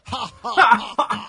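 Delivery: exaggerated ha ha laugh; loud hammy fake laugh; forced laugh. Shown as a demonstration. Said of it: "I laughed incorrectly".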